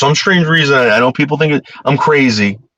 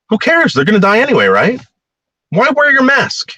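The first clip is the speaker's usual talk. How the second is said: high, mock-dismissive rhetorical question; sarcastic challenge; 10 semitones higher than usual. Speaker mockingly challenging those who fear death, sarcastic challenge. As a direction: throw it out high, mock-indifferent.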